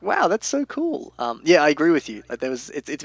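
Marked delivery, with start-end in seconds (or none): 0.0-1.1 delighted. Retold or imitated. Retold own delighted reaction.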